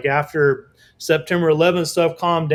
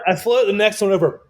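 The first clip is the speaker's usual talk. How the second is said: usual speaking voice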